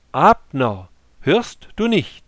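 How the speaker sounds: loud, high call across the valley. A narrator's reading. David calls across the valley to Abner, loud and high. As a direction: shout over to the camp from the hilltop. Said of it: "rief er zum Kriegslager".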